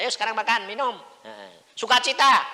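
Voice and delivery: high, loud cheering on; loud urging. Retold or imitated. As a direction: lift it up with joy. Urging joy.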